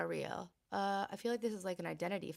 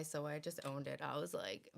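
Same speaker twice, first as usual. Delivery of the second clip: ordinary talk, nothing marked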